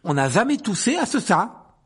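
loud, high fierce scolding. A narrator's reading. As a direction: snap at his brother. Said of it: "dit Percy d'un ton féroce". (ton féroce et fort)

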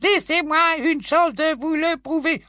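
high, mocking squeak; squeaky little voice. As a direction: mimic a pleading little witch. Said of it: "petite voix couinante".

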